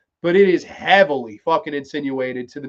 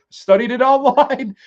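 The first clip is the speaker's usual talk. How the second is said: laughing line; 8 semitones higher, 7 dB louder than usual. Retold or imitated. Robot husband's cheeky answer.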